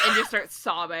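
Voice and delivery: normal talking voice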